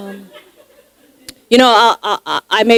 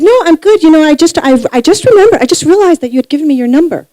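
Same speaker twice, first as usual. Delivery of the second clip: higher, louder, rushed chatter; Natasha's eager babble; 7 semitones higher, 4 dB louder, faster than usual. Retold or imitated. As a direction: gush it out nervously and fast. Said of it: "she said".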